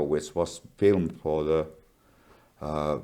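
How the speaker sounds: normal talking voice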